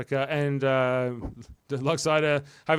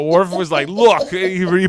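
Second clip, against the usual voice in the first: laughing; 5 semitones higher, 10 dB louder than usual. Real, not acted. Laughing while quoting Worf.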